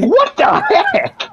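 high, slow startled squeak; retold surprise. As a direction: squeal it in shock. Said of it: "and I was like".

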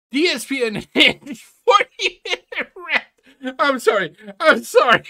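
words breaking into laughter; giggling helplessly while reading; laughing. Real, not acted. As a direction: laugh through the title and apology. Laughing at the video title.

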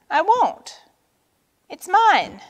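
high, loud, indignant refusal; defiant. A narrator's reading. Vulture keeping the bracelet from Turtle, defiant. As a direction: insist on ownership sharply.

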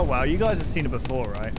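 ordinary talk, nothing marked

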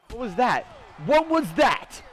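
loud, high and escalating; taunting shout. Real, not acted. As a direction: yell the challenge at a rival, building. Taunting opponent after a hit.

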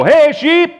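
high, loud holler to the flock; calling out. Acted. Shepherd coming into the barn, calling out. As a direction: call the sheep from across the field.

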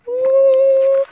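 high, loud drawn-out "ooh"; playful ooh. Pretend. Reader reacts to sharks for the children, playful high ooh. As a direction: squeal a long high ooh at the danger.

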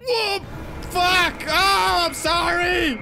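high, loud frantic screams; panicked shrieks. Real, not acted. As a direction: yelp and beg in real panic. Caught by dad, panic.

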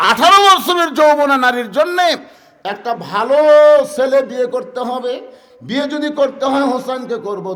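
loud, high shouted sermon; fervent shouted waz delivery; preaching at full force. Acted. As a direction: cry it out to the congregation.

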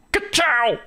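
high, punchy catchphrase; Lightning McQueen. Pretend. Playing a race car cast member, excited catchphrase. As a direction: shout it as a cocky cartoon race car. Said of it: "the character that I could play would be Lightning McQueen".

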